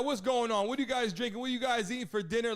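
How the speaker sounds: usual speaking voice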